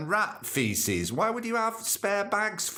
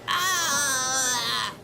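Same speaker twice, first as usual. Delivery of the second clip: angry yell; 8 semitones higher, 3 dB louder than usual. Acted. Shopper cries out.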